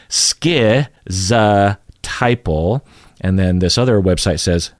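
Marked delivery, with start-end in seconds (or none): 0.1-2.8 "schizotypal" slowly with skiz and tai; imitating Google. Teacher imitating Google's pronunciation. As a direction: say "schizotypal" like a text-to-speech voice. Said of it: "Google says".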